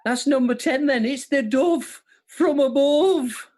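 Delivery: high, drawn-out Birmingham catchphrase; sing-song Brummie, pitched well up; exaggerated Birmingham impression; Brummie accent. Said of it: "didn't know I was doing Brummie".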